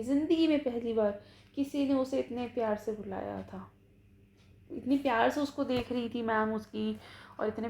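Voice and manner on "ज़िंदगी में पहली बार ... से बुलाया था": ordinary delivery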